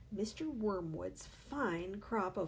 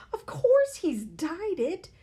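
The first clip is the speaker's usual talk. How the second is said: slow, high, loud exclamation; crying out; 10 semitones higher, 10 dB louder, slower than usual. A narrator's reading. Mother crying out that the father dyed his hair, high and loud. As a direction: insist it in outrage. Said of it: "the mother cried".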